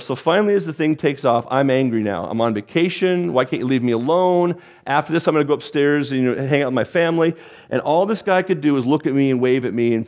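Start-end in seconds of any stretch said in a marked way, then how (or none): none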